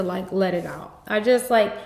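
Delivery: normal talking voice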